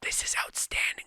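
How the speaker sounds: hushed, amazed whisper; stunned hushed delight. Retold or imitated. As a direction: whisper it as if you can't believe it. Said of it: "you guys don't know".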